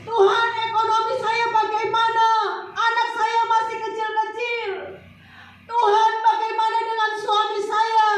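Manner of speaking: high, loud, wailing plea to God; desperate pleading prayer. Acted. Preacher voicing a desperate believer crying to God. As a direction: beg God for help, half singing. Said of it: "Menangis menjerit-jerit".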